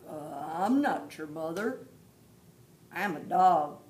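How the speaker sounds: a hint of a gruff dog. Dog answers the baby bird, lightly gruff. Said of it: "said the dog".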